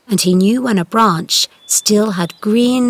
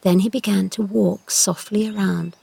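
ordinary delivery

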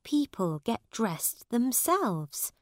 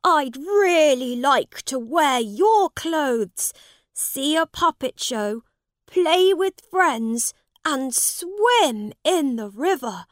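longing prince, voice raised; eager young prince; 6 semitones higher, 8 dB louder than usual. Acted. Prince longs for Tom's life.

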